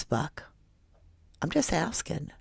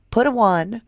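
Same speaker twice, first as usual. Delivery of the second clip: mock pleading; 6 semitones higher, 6 dB louder than usual. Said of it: "I'm gonna reverse psychology you".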